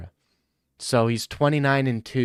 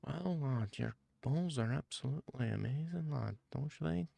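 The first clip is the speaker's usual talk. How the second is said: much softer, hushed English lad voice; Tom Aspinall whisper impression; 15 dB quieter than usual. Joking impression of the fighter hyping a mate, hushed. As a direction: croon compliments in a soft lad murmur. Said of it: "It's my impression of Tom Aspinall in a Whisper".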